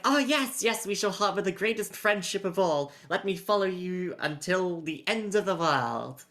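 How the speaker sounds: put-on high, gushing childlike delight; extremely upbeat, girlish high voice; upbeat childlike woman. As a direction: gush like an overjoyed new best friend. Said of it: "extremely upbeat, like, I don't know, childlike young woman".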